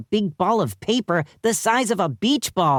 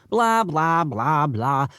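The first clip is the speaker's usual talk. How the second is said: droning lecturer; slower than usual. A narrator's reading. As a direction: drone on like a tedious professor. Lecture drones on.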